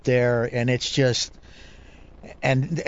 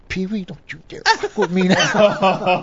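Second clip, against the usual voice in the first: half-laughing, higher mock threat; laughing warning; 5 semitones higher, slower than usual. Angler telling Pee Wee not to mess with him, laughing. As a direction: warn him off while cracking up. Joking warning to weigh-in man.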